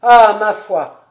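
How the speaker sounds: loud, slow, hearty outburst; exclamation. A narrator's reading. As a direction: burst out heartily, stretching the words. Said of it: "s'écria le gros homme". (s'écrier fort, avec entrain)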